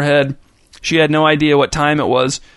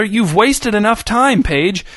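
angry shout; 6 semitones higher, slower than usual. Acted. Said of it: "shouted Page's father".

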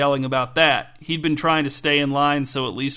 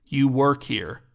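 mildly conspiratorial, confiding accusation; slower than usual. A narrator's reading. Kay accusing Paula in line, conspiratorial. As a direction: lean in as if sharing a secret. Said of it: "his tone conspiratorial".

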